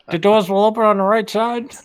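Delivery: high tinny PA announcement; subway announcer. Retold or imitated. Cohost echoing the train announcement, mock PA voice. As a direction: read it like a train speaker. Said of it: "train announcement".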